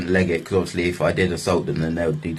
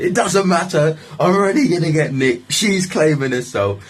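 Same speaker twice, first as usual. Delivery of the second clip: high-pitched exasperated retort; agitated protest; 8 semitones higher than usual. Retold or imitated. Man answering officers who warn him, agitated protest. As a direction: argue back, voice raised in pitch.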